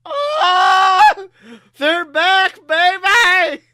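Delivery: high, loud promo yell; excited shout. Retold or imitated. Quoting the wrestler's excited promo to his girlfriend, a big yell. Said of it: "I just read".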